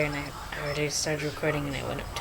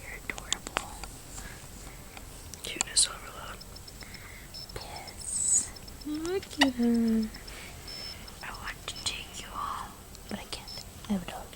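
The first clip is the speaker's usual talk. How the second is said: whispering; 7 semitones higher, 7 dB quieter than usual. Real, not acted. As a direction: hush it, barely voiced. Whispering beside the sleeping baby.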